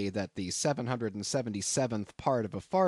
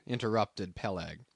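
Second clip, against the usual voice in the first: plain reading voice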